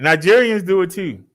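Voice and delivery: usual speaking voice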